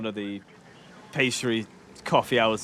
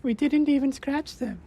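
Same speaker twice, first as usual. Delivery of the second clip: very high squeaky blah blah; mocking squeaky babble. Gamer mocks his failed attack in a very high squeaky voice. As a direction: squeak it like a cartoon mocking the failure.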